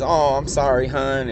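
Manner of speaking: put-on light Southern accent. Drunk coworker apologizing to her ride, lightly imitated Southern accent. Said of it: "she's got a really bad Southern accent".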